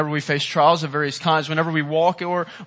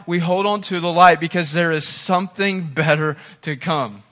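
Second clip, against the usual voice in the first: ordinary talk, nothing marked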